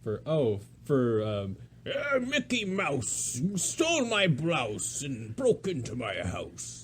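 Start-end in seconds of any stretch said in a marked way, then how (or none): 1.9-6.8 slow gruff cartoon bully; Pete voice. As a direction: drawl it like a cartoon villain cat. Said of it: "That's what Pete sounds like".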